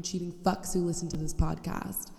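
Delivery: normal talking voice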